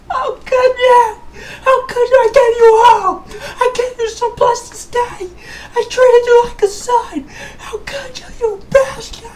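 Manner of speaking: high, loud crying shouts; betrayed wailing. Acted. King crying out at Trey, high and loud. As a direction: wail at the traitor son.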